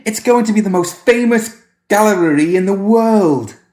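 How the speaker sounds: put-on high, loud delighted boy; cheering child. Boy cheering about his famous gallery, high and loud.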